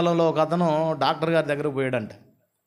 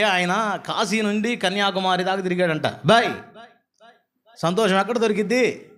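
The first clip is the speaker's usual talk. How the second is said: higher, rising preacher's cry; fervent preaching; 7 semitones higher, 4 dB louder than usual. Acted. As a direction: preach it with growing fervour. Preacher builds fervent testimony. (ఉత్సాహంగా బోధించడం)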